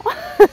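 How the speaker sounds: short high amused laugh. Real, not acted. Storyteller amused by the boyfriend's French question, short laugh. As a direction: giggle at your own imitation.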